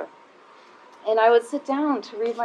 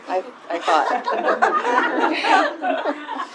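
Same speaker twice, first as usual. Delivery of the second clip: laughing; 10 semitones higher, much slower than usual. Real, not acted. Joking about being interesting.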